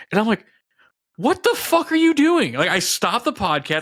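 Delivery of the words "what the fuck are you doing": high, loud, slow-stretched incredulous demand; exasperated outburst. Retold or imitated. Boyfriend confronting his partner over the balls remark, appalled. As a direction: act out appalled disbelief at full voice.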